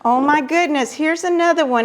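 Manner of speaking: usual speaking voice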